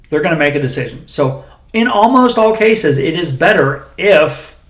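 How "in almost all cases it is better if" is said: slow, weighty build to a stressed "if". Real, not acted. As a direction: draw the line out and land hard on "if". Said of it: "and I say if".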